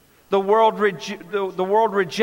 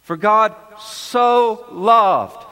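"so" stretched long and weighted, slow; slow, deliberate verse, "so" emphasised; stress on so; much slower than usual. Shown as a demonstration. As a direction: press down hard on "so". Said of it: "It's the emphatic word".

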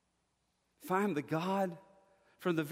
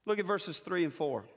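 ordinary talk

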